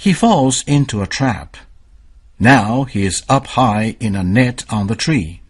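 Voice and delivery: ordinary delivery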